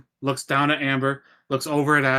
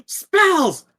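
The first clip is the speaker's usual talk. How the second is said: much higher and louder, bursting with glee; excited shout; 7 semitones higher, 4 dB louder, slower than usual. Acted. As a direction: blurt it out like a thrilled kid. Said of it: "so he's gonna be like".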